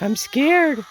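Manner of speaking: high, loud mock-frightened wail; imitated crying chicken. Owner voices her crying chicken, high mock-scared voice. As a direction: play a scared little bird, high and loud. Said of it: "He's crying".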